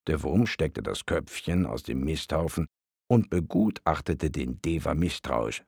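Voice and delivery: plain reading voice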